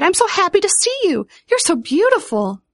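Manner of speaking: high-pitched gushing delight; thrilled. A narrator's reading. Vivian to the only flower of spring, thrilled. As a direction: gush with happiness, voice lifted high. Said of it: "thrilled down to her toes".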